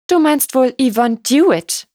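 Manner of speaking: catty question with a lift at the end; pointed jibe. A narrator's reading. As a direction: get a dig in as a haughty lady. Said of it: "konnte sich Lady Angela nicht verkneifen".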